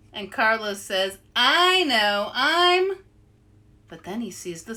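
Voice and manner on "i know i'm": boastful. Acted. Carlos boasting, cut off.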